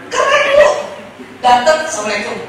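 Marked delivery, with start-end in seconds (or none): 1.8-2.4 low, soft, unbothered greeting; cool. Acted. A husband coolly greeting his wife at the door, cool.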